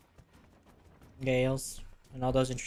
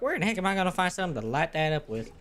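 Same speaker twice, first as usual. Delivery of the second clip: exasperated self-quote; 6 semitones higher than usual. Retold or imitated. Gamer recalling an earlier puzzle to viewers, mock-baffled. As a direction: replay your own baffled question, voice raised high. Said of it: "I was like".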